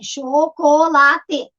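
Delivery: "Chocolate" very slowly counted out, cho... co... la... te; "Chocolate" stretched, every syllable on its own. Shown as a demonstration. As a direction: break "Chocolate" into four evenly spaced syllables. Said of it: "quantos pedacinhos tem?".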